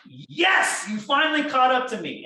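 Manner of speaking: bright, loud, high cheerful welcome; cheery mock greeting. To the officer who pulled him over, cheerily loud and high. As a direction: greet the cop like an old friend you've been waiting for.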